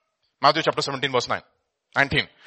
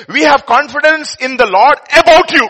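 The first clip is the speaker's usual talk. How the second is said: loud, higher proclaiming of the verse; emphatic scripture reading; 10 semitones higher, 10 dB louder than usual. Real, not acted. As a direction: declare it loudly. Scripture read loudly.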